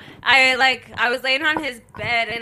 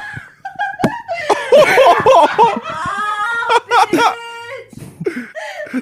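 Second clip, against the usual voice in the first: loud high crying laugh; wheezing laugh; 11 semitones higher, 6 dB louder than usual. Real, not acted. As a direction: laugh till it sounds like crying. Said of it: "That sounded hard".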